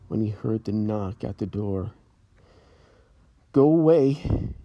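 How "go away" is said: loud, higher-pitched shout, drawn out. A narrator's reading. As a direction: shout it to drive someone off. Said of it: "he shouted".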